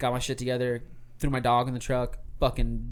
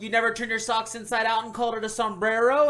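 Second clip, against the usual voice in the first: imitating Zach; 7 semitones higher, 5 dB louder than usual. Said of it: "And he goes, he goes".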